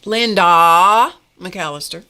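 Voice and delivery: loud, slow, drawn-out name; happy to see her; fond greeting. Real, not acted. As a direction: savour her name warmly and loudly. Greeting viewer again.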